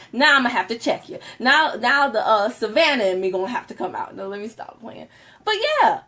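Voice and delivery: ordinary talk, nothing marked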